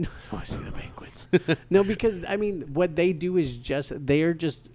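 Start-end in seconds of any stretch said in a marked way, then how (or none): 0.0-1.3 fast soft Batman whisper. Retold or imitated. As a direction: breathe it like a brooding Batman. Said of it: "a lower tone in their whispers".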